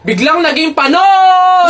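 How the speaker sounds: slow, rising hype shout; shouted punchline. Acted. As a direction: stretch out the punchline as a shout for the crowd. Punchline in acted rap battle.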